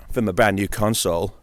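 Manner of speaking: ordinary delivery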